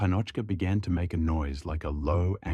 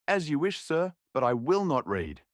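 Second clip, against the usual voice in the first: firm refusal; 7 semitones higher than usual. Acted. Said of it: "said Homer strongly".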